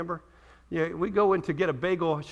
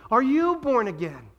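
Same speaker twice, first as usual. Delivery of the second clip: loud, high, slow challenge; emphatic challenge; 7 semitones higher, 5 dB louder than usual. Real, not acted. Preacher turning the tables on the congregation, loud emphatic challenge. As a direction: press the question forcefully on the listener.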